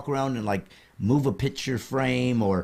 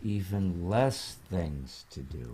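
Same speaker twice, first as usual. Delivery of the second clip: spooky ghost voice; 8 semitones lower, 8 dB quieter, slower than usual. Pretend. Host mocks ghost in low voice.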